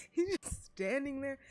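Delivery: real laughter through the words; laughing. Real, not acted. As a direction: burst out laughing while talking. Laughing at game character.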